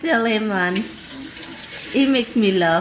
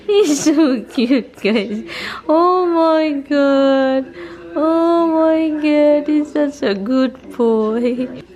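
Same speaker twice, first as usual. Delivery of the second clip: gushing over pet; 6 semitones higher, 5 dB louder, slower than usual. Real, not acted.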